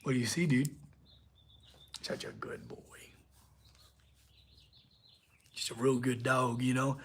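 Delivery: tender low dog talk; cooing to dog. Real, not acted.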